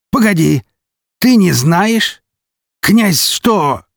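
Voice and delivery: high, drawn-out amazed question; stunned, on the verge of delight; incredulous. A narrator's reading. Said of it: "Он неожиданно расхохотался". (недоверчиво, высоко, с растущим изумлением)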